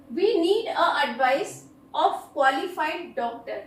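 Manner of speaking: very slow, every word spaced out; slow dictation. Shown as a demonstration. Teacher reading an example sentence very slowly to students. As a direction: read it out slowly so students can write it down.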